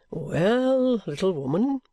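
lightly voiced guardian greeting his ward; kindly guardian, affable. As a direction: play the benevolent guardian, at ease.

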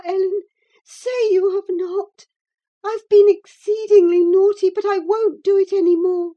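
put-on high, tearful girlish pleading; frantic begging. A narrator's reading. Girl begging Ellen not to tell papa, tearful pleading. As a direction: beg desperately in a high voice. Girl begs for her letters.

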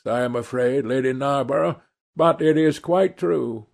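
lightly voiced Lord Henry. A narrator's reading. Lord Henry amusing his hostess, lightly voiced gentleman. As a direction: play the cynical dinner guest, amused and light.

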